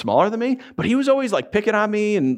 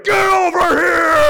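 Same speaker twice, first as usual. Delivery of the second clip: high, loud, drawn-out scream; full-force acted shout; enraged yell; 12 semitones higher, 6 dB louder, slower than usual. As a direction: bellow it like a furious kid giving chase. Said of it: "so angry at this point".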